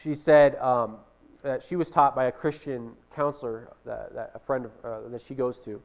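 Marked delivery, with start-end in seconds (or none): none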